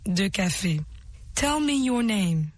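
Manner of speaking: usual speaking voice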